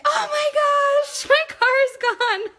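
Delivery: high, loud mock-panicked cry; imitated scream. Retelling friend finding her car gone, high mock scream. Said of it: "she screams".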